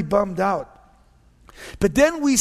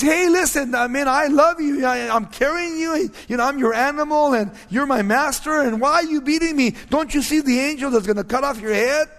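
higher, lightly voiced donkey pleading with its master; 6 semitones higher than usual. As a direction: play the hurt donkey asking why, a touch higher.

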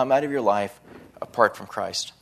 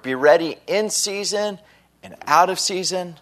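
usual speaking voice

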